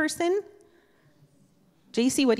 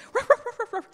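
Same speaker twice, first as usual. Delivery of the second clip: high, loud little yaps; imitated dog barks; 14 semitones higher, 3 dB louder, faster than usual. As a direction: bark like an overjoyed little dog. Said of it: "jump up and go".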